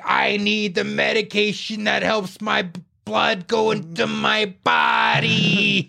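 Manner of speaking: high, long-drawn mock holler; slow, hammed-up high yell; imitated yelling. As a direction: stretch each word into a drunken wail. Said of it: "like, yelling".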